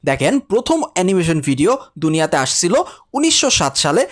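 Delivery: ordinary talk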